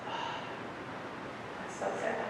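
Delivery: whispered, very soft fake sympathy; mock pity. Mocking someone who just says so sad, in a whisper. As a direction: breathe it out like hollow pity. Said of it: "you've seen someone say".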